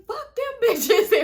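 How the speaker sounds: slow, loud, high scoffing yell; dismissive shout. Retold or imitated. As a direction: blurt it out while fleeing. Steve's retort before running.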